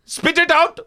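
high, insistent order; hammed-up boss command; mock demand. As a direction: bark it like a furious boss. Boss demanding candy spat out.

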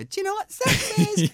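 put-on high squeaky kid voice; schoolkid's question. Mimicking a classmate's cheeky playground question, high and squeaky.

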